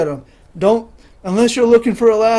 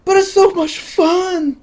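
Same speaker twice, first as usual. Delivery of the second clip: mock innocent glee; 10 semitones higher, slower than usual. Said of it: "I'm like".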